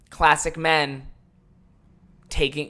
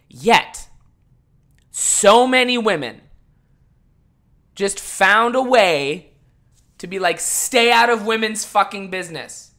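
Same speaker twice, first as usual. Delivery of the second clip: indignant rant; 6 semitones higher, 9 dB louder than usual. Real, not acted. Ranting about women commenters.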